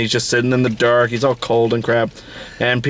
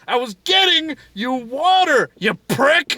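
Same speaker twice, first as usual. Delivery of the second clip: high-pitched, hammed-up indignation; mock outrage; 12 semitones higher than usual. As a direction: curse him in mock pain. Acting wounded Peter cursing the guard.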